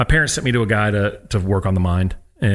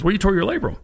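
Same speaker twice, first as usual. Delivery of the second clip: higher, matter-of-fact doctor voice; a hint of a gruff doctor, pitched high; blunt diagnosis; 10 semitones higher than usual. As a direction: state it like a blunt surgeon. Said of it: "He goes".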